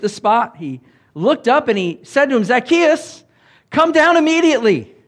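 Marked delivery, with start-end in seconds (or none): 2.4-4.9 high, loud call up into a tree. Acted. Jesus calls Zacchaeus down from the tree, loud and high. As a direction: shout up to him to come down at once.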